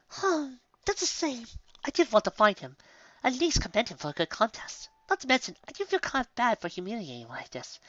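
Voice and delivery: a touch of regret; lightly voiced unicorn feeling bad; Rarity's voice. A narrator's reading.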